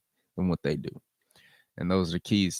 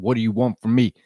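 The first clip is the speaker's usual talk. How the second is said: retold snap; 3 semitones higher, 6 dB louder than usual. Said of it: "The man finally snaps and said".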